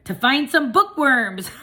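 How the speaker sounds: loud, high punchline with a laugh; gleeful punchline. A narrator's reading. Kid delivers his fish joke to the hamster, laughing. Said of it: "gleefully answered".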